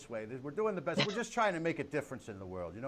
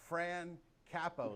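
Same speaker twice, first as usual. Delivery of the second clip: announcer intro; 4 semitones higher, much slower than usual. Acted. Host announcing first panelist.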